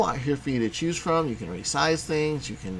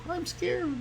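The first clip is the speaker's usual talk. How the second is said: very high, soft, tiny frightened squeak; squeaky scared alien; 6 dB quieter than usual. Pretend. Teacher voicing the alien's scared thought, high squeak. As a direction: squeak it out like a terrified little alien.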